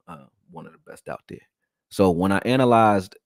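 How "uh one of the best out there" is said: sly whispered boast; whispered aside. Real, not acted. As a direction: whisper the brag to yourself. Whispered self-praise aside.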